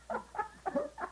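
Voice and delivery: high, airy, voiceless giggle; a silent wheezing laugh; short breathy laugh mid-joke; breathless laugh. Real, not acted.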